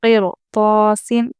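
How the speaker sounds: slow फिर तौसिन, र said full; heavy ra recitation. Shown as a demonstration. Teacher reciting the drill word slowly for the class. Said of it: "यहाँ भी रॉ को हम पुर पढ़ेंगे".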